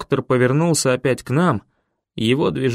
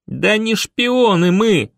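higher, slow, deliberate shout; 7 semitones higher, slower than usual. A narrator's reading. Boy protesting to the doctor, drawn-out shout. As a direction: shout each word to make them hear. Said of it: "снова закричал я".

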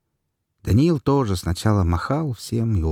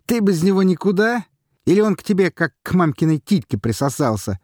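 high, loud, laughing tease; mock him with a laugh; laughing teasing question; 12 semitones higher, 4 dB louder than usual. A narrator's reading. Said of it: "засмеявшись, спросил комвзвод".

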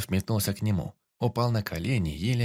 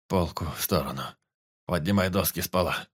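weak forester; 3 semitones lower than usual. A narrator's reading. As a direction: ask as if barely able to see him. Forester commands.

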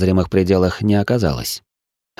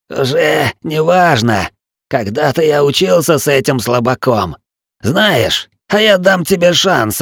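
higher, hollow croaking villain; croaking raven voice; 6 semitones higher than usual. A narrator's reading. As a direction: caw the words like a raven. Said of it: "глухим и каркающим, будто у ворона".

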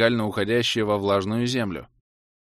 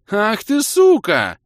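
much higher, much louder howl; howling curse; 11 semitones higher, 9 dB louder, much slower than usual. A narrator's reading. Hero howling a curse at the cobra. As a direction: scream the curse at her. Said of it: "завопил я".

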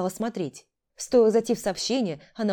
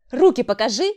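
loud, raised-pitch command; demanding; 5 semitones higher, 8 dB louder than usual. A narrator's reading. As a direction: order it, brooking no refusal. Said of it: "потребовала Ярцева". (требовательно)

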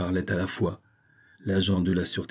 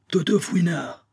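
high excited shout of the name; cri de joie; 10 semitones higher than usual. A narrator's reading. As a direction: call out his name like a rescue. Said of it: "Un cri jaillit de toutes les poitrines". (cri enthousiaste)

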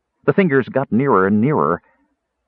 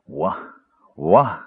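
babbling; 5 semitones lower than usual. Acted. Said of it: "Caramon was babbling".

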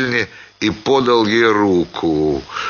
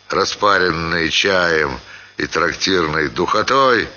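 plain reading voice

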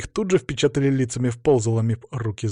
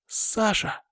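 woman calling out; 9 semitones higher, 3 dB quieter than usual. A narrator's reading. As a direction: call his name in a high woman's voice. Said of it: "крикнула она". (женский окрик высоким голосом)

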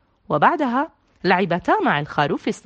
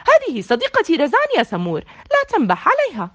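firm and a little louder, rising at the end; 7 semitones higher, 4 dB louder than usual. A narrator's reading. Zein firmly telling the dog not to bark at her friend. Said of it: "قالت زين بحزم لسمور".

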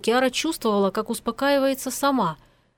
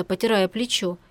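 plain reading voice

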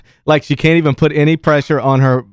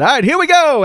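higher-pitched call to launch; rousing send-off; 9 semitones higher than usual. Retold or imitated. Sending a kid down the zip line, rousing send-off. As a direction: gear up for a retold countdown push. Retold send-off before the push.

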